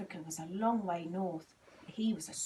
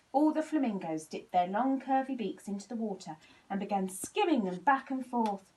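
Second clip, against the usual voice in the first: ordinary delivery, nothing marked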